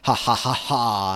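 slow, hammed-up evil cackle; deliberate villain "ha ha" laugh. Sue gloating over the trapped boys, evil laugh. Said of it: "in this very evil, distorted voice".

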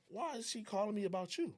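high-pitched retold complaint; indignant questioning. Asking why she called about someone else, indignant. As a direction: act out demanding an explanation.

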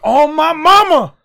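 loud, slow, high preacher-like hype; Deion Sanders impression. Imitating Deion Sanders's speech to his friend. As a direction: proclaim it like a proud coach. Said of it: "He's like".

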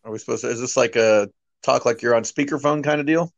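normal talking voice

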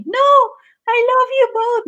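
imitación de la reina Anne. Retold or imitated. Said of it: "cuando entra la reina y". (reina melodramática imitada, con voz aguda y alargada)

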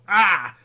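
a sudden loud, high yelp at a flubbed take; frustrated yell. Retold or imitated. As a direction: blurt it out like you just messed up on camera. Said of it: "I'll go".

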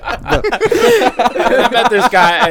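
slow, high, laughing words. Real, not acted. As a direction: laughs through the line.

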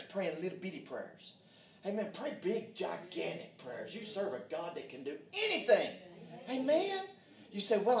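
loud, rousing preacher's cadence, pushing each line; rally the church with a loud, stretched preaching call; preaching at full force. Acted. Preaching to the congregation.

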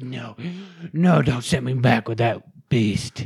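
slow, loud, drawn-out whine; mock pleading toddler. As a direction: beg dramatically like a clinging child. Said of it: "She's like".

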